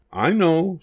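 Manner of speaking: high, bright monkey voice with an idea; little monkey's idea. Acted. One little monkey announcing a plan, high and bright. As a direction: pipe up like a clever little monkey.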